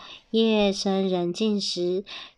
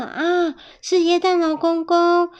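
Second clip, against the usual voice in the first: high, loud gasp of recognition; excited surprise; 8 semitones higher, 5 dB louder than usual. Acted. Finding Santa at the door, excited surprise. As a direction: exclaim at a wonderful visitor.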